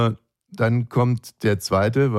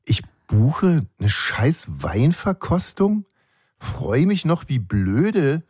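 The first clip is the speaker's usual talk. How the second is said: slow, higher, emphatic mock outrage; retold seething; 3 semitones higher than usual. Guest fumes inwardly about the wine tasting, slow indignant voice. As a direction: draw out each grievance with indignant stress. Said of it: "meine Halsschlagader fing an zu puckern".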